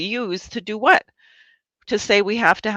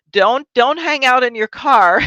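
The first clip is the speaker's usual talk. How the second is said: higher, louder mocking imitation of the announcer; mock PSA lady; 8 semitones higher, 7 dB louder than usual. Mocking the city video's announcer to a friend, higher and louder. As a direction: ham up the lady's urgent warning, raised and loud. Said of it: "And then she says".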